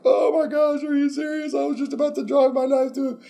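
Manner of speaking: Abraham yelping in disbelief; shocked Abraham. Acted. Abraham's shocked reaction voiced.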